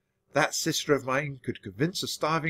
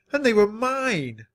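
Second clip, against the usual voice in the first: high, slow, hurt protest; dismayed cry; 8 semitones higher than usual. A narrator's reading. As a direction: plead it, raised and slowed. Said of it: "I cried".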